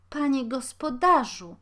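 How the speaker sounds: calling out. A narrator's reading. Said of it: "zawołała kobieta".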